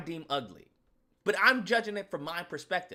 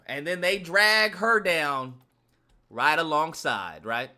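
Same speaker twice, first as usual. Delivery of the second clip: normal talking voice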